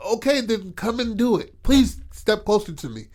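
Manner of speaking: retold dare. Retold quarrel, daring the old man.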